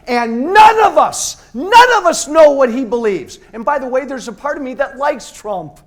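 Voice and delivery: high, loud, angry yelling; furious shout. Real, not acted. Speaker shouting at the crowd about the Republican Party, furious. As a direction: rail against the party at full voice.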